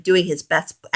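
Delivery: plain reading voice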